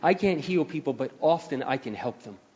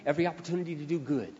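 soft quick repeat; 6 dB quieter, faster than usual. Acted.

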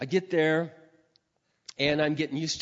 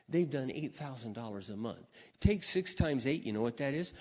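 ordinary talk

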